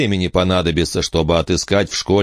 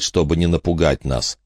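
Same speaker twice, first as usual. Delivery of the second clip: ordinary delivery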